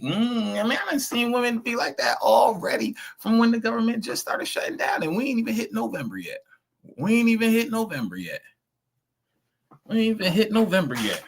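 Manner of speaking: incredulous, voice shooting way up high; very high, falsetto-like incredulity; high-pitched disbelief. Real, not acted. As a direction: squeal it in exasperated disbelief. Incredulous at how quickly women changed.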